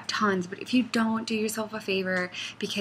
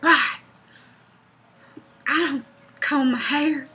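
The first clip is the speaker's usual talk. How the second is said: goof around in a loud hillbilly southern voice; a mildly hammed-up southern woodsman, louder; southern forest person; 5 semitones higher, 8 dB louder than usual. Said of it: "why the forest person would be southern".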